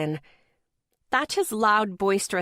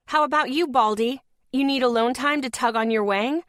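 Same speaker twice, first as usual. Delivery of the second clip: raised, higher heckling at a stranger; ranting heckle; 5 semitones higher, 3 dB louder than usual. Retold or imitated. Woman heckling a passing stranger, loud and ranting. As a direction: call out a passing man mid-rant. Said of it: "I was already on a tirade".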